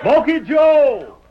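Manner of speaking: loud slow call; shouting. Acted. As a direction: bellow the name down the canyon. Sheriff calls into the canyon.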